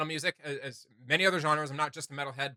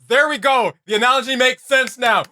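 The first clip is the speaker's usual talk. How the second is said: loud, high, relieved victory call; triumphant cheer; 8 semitones higher, 11 dB louder than usual. Real, not acted. Host cheering to co-host after finding the word, triumphant and loud. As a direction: cheer like you just solved it. Finally finds the right analogy.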